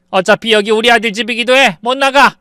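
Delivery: high, loud screeching refusal; shrieking defiance. Acted. 버티는 시어머니가 며느리에게 악을 쓰며 높고 크게 소리침. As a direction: scream it out stubbornly. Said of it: "시어머니가 악을 썼습니다".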